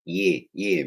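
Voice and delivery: high-pitched mimicked South African yeahs; clipped-in South African catchword, twice; South African accent. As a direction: imitate the South African "yeah, yeah". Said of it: "みたいなアクセントがあるからね、 南アフリカの人は".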